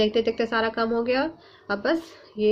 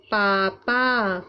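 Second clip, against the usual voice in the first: पापा drawn out very slowly; teacher reading पापा slowly; slow modelled word; much slower than usual. Shown as a demonstration. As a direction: stretch पापा for the class. Teacher reads new word slowly.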